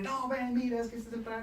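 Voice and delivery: mild mock salesman; long-winded pitch. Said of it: "Mientras que uno se pone".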